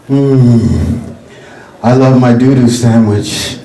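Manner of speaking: low, slow, relishing every bite; savoring weirdo. Acted. To a mugger, low slow savoring. Acted eating doo-doo sandwich.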